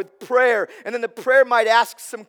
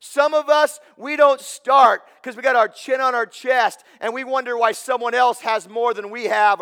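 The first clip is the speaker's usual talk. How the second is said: preaching; 3 semitones higher, 6 dB louder than usual. Real, not acted. Preaching at full force.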